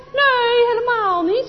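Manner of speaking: high loud brave denial; tough. Acted. Anneke to Chris, loud high tough denial. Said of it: "zegt Anneke stoer".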